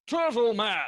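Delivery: high, loud encouraging yells; rousing shouts of encouragement; cheering shout. Acted. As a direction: cheer him on from across the room. NPC cheering on a friend.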